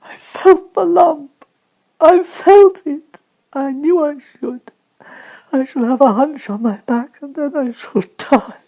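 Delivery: frightened invalid sobbing; crying in terror. Acted. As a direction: wail it through tears.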